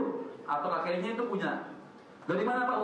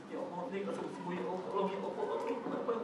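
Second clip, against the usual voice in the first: soft, pleading mock sob to God; softer pleading prayer; tearful prayer; 6 dB quieter than usual. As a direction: quietly beg like weeping in prayer. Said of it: "kita kan sama Allah nangis".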